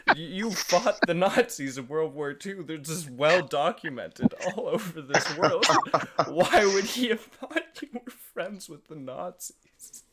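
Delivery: loud, high-pitched laughing disbelief; mock incredulity. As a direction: exclaim it in amused mock outrage. Laughingly mocking a film line.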